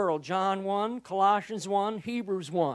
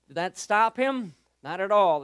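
ordinary talk, nothing marked

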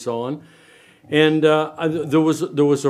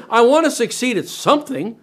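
mock eager voice; 9 semitones higher, 3 dB louder than usual. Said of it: "they're gonna say".

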